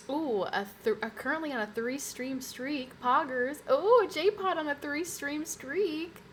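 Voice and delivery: high, loud hype for a streak; excited shout-out. Real, not acted. Streamer hailing a viewer's three stream streak, excited.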